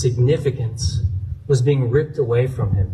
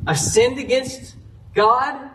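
high, drawn-out acted plea; imitated desperate confession; begging for mercy; 8 semitones higher, slower than usual. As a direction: plead with rising pitch, unsure of pardon. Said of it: "He starts begging. He cries out for mercy".

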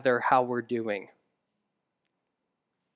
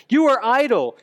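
higher and louder, accusing; booming Pharaoh; 7 semitones higher, 4 dB louder than usual. Acted. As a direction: bark the accusation. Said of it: "the voice of the taskmaster".

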